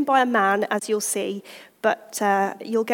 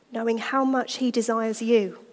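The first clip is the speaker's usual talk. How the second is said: ordinary talk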